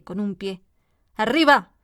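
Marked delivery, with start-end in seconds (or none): 1.1-1.7 louder, sharp barked order; booming shout. A narrator's reading. Queen ordering the gardeners up with a booming shout. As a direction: blast the command out. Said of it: "en voz fuerte y detonante".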